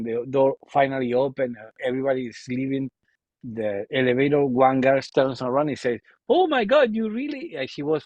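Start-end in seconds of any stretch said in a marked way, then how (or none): 6.3-7.6 high-pitched mock Spanish-woman exclamation; imitated Spain accent. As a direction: mimic an astonished Spaniard, pitch jumping up. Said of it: "the accent from Spain".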